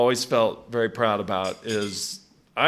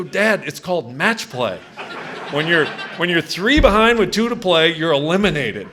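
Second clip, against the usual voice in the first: laughing retold reply; 7 semitones higher, 8 dB louder than usual. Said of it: "I go".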